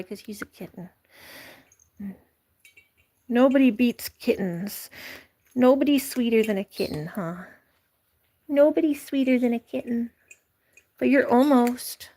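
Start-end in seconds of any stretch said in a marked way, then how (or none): none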